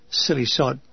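plain reading voice

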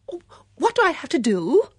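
high, loud, helpless cry; acted tearful fluster; flustered wail. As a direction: wail in confusion. Flustered guest in the dark.

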